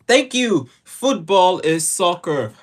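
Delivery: high, loud, drawn-out insistence; emphatic correction. Real, not acted. To the viewer, insisting football is soccer, high and loud. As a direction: stretch it out, triumphant.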